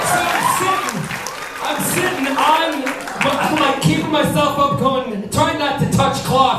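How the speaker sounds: high, strained storytelling voice; comic strained panic. Retold or imitated. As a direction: tell it as if still holding yourself up in agony. Acting out his car predicament.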